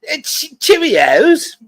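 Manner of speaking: high, long-drawn British Cheerios; mock British accent. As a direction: stretch it out in a high put-on British voice. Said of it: "You could give him a Cheerio".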